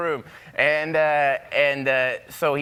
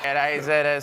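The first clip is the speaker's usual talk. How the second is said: ordinary talk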